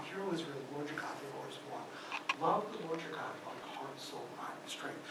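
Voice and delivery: rushed, hushed recital falling to a whisper; whispered recitation. Shown as a demonstration. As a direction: let the verse drop to a breathy whisper. Reciting the Shema, fading to whisper.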